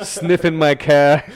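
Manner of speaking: slow, loud mock Jersey accent. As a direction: stretch it out in hammed-up Jersey, pitched high. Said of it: "I went for the Jersey accent for that".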